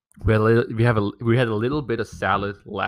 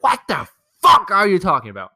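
louder and higher, fed-up outburst; exasperated retort; 10 semitones higher, 8 dB louder than usual. Retold or imitated. Answering mom's nitpicking about the bread knife, loud and exasperated. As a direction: blurt it out like you've had enough.

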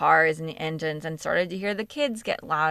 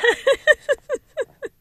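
loud high-pitched giggle; high burst of laughter; 7 dB louder than usual. Real, not acted. Podcaster laughing at yawning during recording. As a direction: laugh it off brightly.

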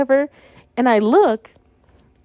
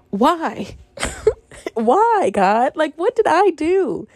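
exasperated why; 5 semitones higher, much slower than usual. Real, not acted. Despairing at God.

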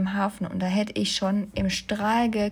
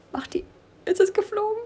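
ängstlich; 10 semitones higher than usual. Real, not acted. Panik vor dem Käfer.

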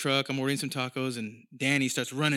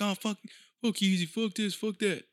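higher-pitched mock angry fans; mock outrage; 6 semitones higher than usual. Podcaster mimics people hyped up at a rapper, mock outrage. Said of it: "all mad, like".

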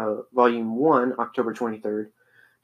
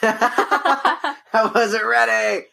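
laughing protest; 10 semitones higher, much slower than usual. Real, not acted. Caught off guard recording.

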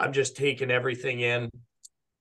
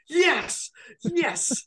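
much higher, sing-song calling out; eager official. Retold or imitated. Official drives up waving a club at the player: high eager call.

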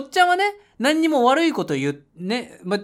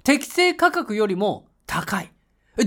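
normal talking voice

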